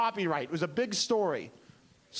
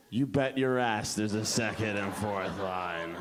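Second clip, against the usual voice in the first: slow, very low, self-assured reply; cocky songwriter; 11 semitones lower, slower than usual. Acted. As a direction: drop way down and take your time. Mildred answers, cocky about her song.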